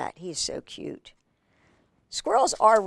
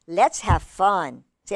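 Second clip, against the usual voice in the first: plain reading voice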